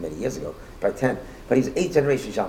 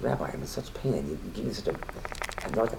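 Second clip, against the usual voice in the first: pained; 7 dB quieter than usual. Retold or imitated. Devastated man answers the rabbi.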